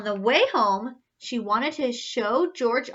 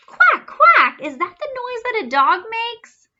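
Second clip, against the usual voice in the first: much higher, louder outraged question; shocked mother; 11 semitones higher, 7 dB louder than usual. A narrator's reading. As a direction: sound scandalized.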